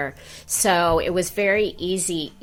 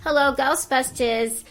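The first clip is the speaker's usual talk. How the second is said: receptionist's New York accent, pitched up at first; Janine; 4 semitones higher than usual. Shown as a demonstration. Secretary reports ghost calls to Ghostbusters, in character. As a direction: answer the phone as a brisk New York secretary. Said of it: "I certainly can do Janine".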